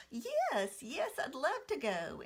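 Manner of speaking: put-on high, bright child's voice, +15 then easing; eager little girl. Little sister answering big sister, eager child voice. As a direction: squeal yes like an excited three-year-old.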